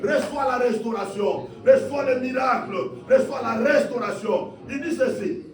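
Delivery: high, driving sermon delivery; impassioned preacher in full cry; full-force preaching. Real, not acted. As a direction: proclaim it to the whole congregation.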